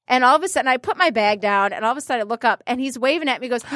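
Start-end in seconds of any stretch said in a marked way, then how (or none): none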